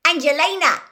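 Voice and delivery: loud, high shout across the room; shouting over the crowd; calling across. A narrator's reading. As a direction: call out to get her attention from afar. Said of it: "called across the common room".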